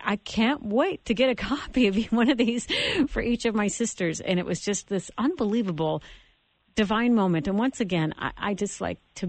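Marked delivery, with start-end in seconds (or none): 0.0-4.2 higher, laughing eager quote; joyful, laughing anticipation; excited quote. Retold or imitated. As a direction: bubble over with delight. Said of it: "And she's like".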